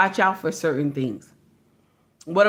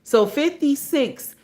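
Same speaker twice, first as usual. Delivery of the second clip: ordinary talk, nothing marked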